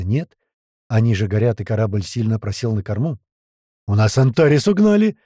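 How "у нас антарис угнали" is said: loud, higher cry of alarm; wailing yell. Acted. Crewman reports the stolen ship, wailing yell. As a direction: howl out the bad news. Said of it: "завопил мамуля".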